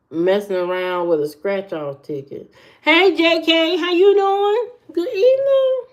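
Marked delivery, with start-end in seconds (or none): 2.8-5.8 very high, bright greeting voice; high sing-song greeting. Real, not acted. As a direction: greet them in a squeaky high voice.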